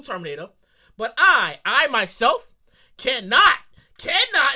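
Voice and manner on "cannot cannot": cannot said twice, hit hard; stress on cannot. Real, not acted. Host insisting he cannot accept the Terminator plot, stressing cannot. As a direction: lean on cannot. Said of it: "and I stress the cannot factor".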